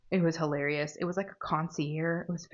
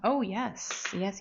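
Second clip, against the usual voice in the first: ordinary talk, nothing marked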